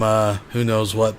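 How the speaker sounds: ordinary talk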